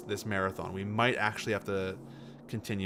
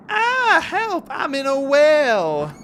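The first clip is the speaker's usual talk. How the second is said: high, loud wail stretched out; cry for help; 8 dB louder, much slower than usual. Acted. Streamer quoting a game character trapped in a well, high wailing cry for help. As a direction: call out in hammed-up distress.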